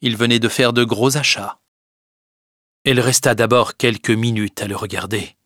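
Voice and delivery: usual speaking voice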